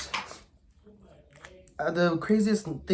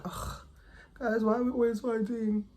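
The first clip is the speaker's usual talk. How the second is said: whiny friend voice; 8 semitones higher than usual. Retold or imitated. Friends' whiny complaint mimicked.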